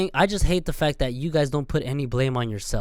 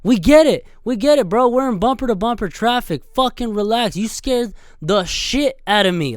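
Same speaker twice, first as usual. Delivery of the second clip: higher and louder fed-up shout; exasperated yelling; 6 semitones higher, 5 dB louder than usual. Real, not acted. Vlogger ranting at bikers who rev in traffic, fed-up yell.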